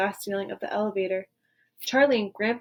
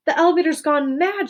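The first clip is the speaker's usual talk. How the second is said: louder, higher frightened cry; alarmed shout; 5 semitones higher, 5 dB louder than usual. A narrator's reading. Grandpa Joe frightened high above the town. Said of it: "shouted Grandpa Joe".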